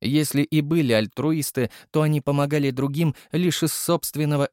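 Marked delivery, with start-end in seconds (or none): none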